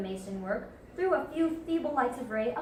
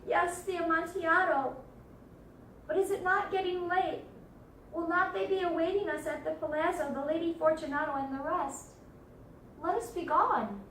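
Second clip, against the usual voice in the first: higher, plaintive character voice; pleading Fortunato; 5 semitones higher than usual. Acted. Fortunato asking Montresor to release him, pleading. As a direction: plead in a raised voice. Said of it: "a sad voice".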